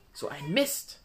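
mildly annoyed, in a different voice; a touch of frustration. A narrator's reading. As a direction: grumble at the bad luck. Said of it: "ärgerte sich Miriam".

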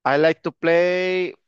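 drawn out slowly; slow teacher prompt; slow model phrase. Shown as a demonstration. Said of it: "pónganselo".

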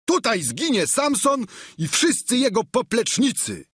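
high-pitched battle cry. A narrator's reading. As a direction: shout it as a squire's last defiance, pitched high. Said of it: "zawołał". (okrzyk bojowy wysokim głosem)

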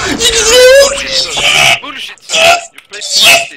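high, loud furious yelling; screaming at his friend over the game; furious shout. Real, not acted. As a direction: yell it out at full force. Angry about the game play.

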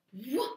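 a rushing whoa like a wave; whooshing whoa. Shown as a demonstration. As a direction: push out the whoa like a wave coming in.

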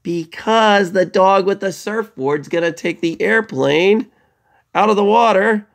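high, slow, building rescue telling; dramatic storytelling. Shown as a demonstration. Teacher tells kids how the dog escapes the shark, high and dramatic. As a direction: sell the happy ending like a hero's rescue.

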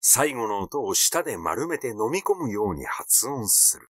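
plain reading voice

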